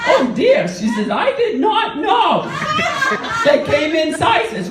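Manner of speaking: high, slow, wide-eyed granny gasp; astonished old lady. Retold or imitated. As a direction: stretch it out high in shocked delight.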